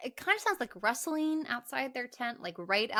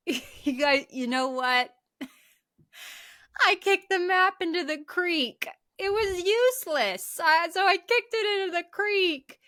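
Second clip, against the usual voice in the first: higher, louder sheepish confession; mock Mike confession; 8 semitones higher, 5 dB louder than usual. Mike admits kicking the map, higher and louder. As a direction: own up with a guilty laugh. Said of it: "he says".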